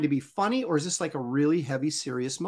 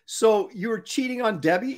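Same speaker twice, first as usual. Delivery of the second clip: ordinary talk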